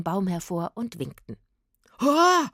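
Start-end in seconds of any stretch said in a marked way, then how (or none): 2.0-2.5 high, loud cry of fright; terrified "ah"; startled scream. A narrator's reading. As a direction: scream out in shock. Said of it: "schrie der Mann auf".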